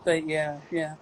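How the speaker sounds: ordinary talk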